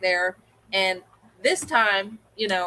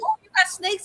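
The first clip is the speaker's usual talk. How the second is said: surprised question; 10 semitones higher than usual. Retold or imitated. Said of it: "And he goes".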